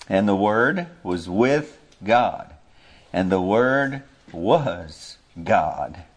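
slow, weighty reading of each word; slow scripture reading. Acted. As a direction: read it slowly and solemnly.

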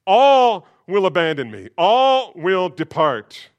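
booming self-assured vow; Peter's loud boast. Acted. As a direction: boast with swagger.

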